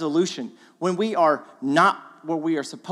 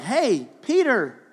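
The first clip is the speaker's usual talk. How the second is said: excited call; 5 semitones higher, 4 dB louder, much slower than usual. Retold or imitated. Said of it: "And so John's like".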